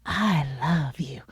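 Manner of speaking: low, slow whispered "I love you"; hushed, intimate declaration; breathy whisper. Shown as a demonstration. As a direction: whisper it slowly and tenderly. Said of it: "people in my life know the difference".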